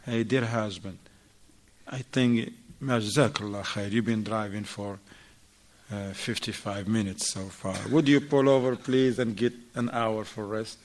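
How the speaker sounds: righteous wife softly asking husband to rest; gentle request. Retold or imitated. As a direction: ask him tenderly to pull over. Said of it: "this is the righteous woman".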